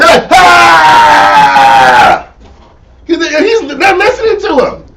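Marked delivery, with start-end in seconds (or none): 0.3-2.3 sudden loud high mock cry; mock wail. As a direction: cry out like a desperate worshipper. Crying aloud to Baal.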